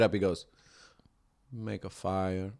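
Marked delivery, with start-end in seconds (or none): 1.5-2.5 put-on slow, hushed Mexican-accented cabbie; low, quiet, deliberate Mexican accent; imitated driver's Mexican accent. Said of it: "the quintessential Mexican accent".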